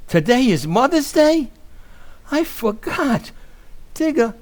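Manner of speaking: panicked realization, voice climbing; guilty alarm climbing in pitch; dismayed outburst. Acted. Riley realizes he forgot.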